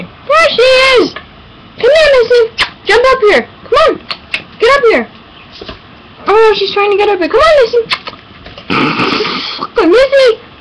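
high, loud calling to the dog; excited dog call. Real, not acted.

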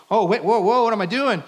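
high, loud sudden panic; acted alarm. Peter on the water as it splashes him, mock alarm.

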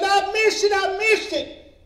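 high, loud acted cry, stretched at the end; panicked wail. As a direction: wail it as the distraught husband. Retold husband wails he missed it.

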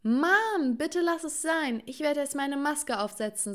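high cry easing into appeasement; alarmed pleading. A narrator's reading. Daughter to mother wielding lampshade, alarmed pleading. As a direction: cry out, then placate in a high voice.

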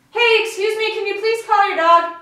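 high, loud, projected request. Shown as a demonstration. Practising the cue on a walk, loud voice. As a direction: shout politely to a distant dog owner. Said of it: "in a loud voice".

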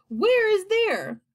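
high, slow, loud question; asking, baffled. A narrator's reading. Creatures questioning the grasshopper, baffled and high. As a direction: demand it high and drawn out. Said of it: "they asked".

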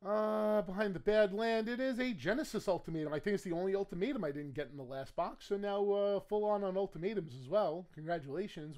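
ordinary delivery

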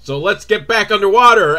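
loud, high eager plan; rally the team; mock eager cop. Cop rallying partners to dive back, eager.

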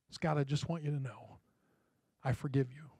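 soft, hushed and sincere; tender forgiveness. Retold or imitated. Preacher voicing the mother forgiving the boy across the table, hushed and tender. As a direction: say it quietly like a gift.

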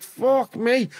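a high, startled burst; stunned. Retold or imitated. Dizzy lad reacting to his first nicotine hit, shocked and high. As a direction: blurt it out as the room starts spinning. Nicotine patch hits him hard.

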